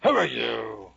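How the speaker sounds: loud, imperious caterpillar voice; Caterpillar's abrupt challenge. Acted. Storyteller as caterpillar confronting Alice, abrupt and booming. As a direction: boom out a sudden demand. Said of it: "Suddenly he said".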